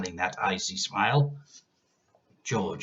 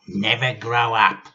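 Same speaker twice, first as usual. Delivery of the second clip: put-on a touch of a bossy old woman; lightly voiced grandma scolding; stern granny laying down rules; 2 semitones higher, 8 dB louder than usual. A narrator's reading.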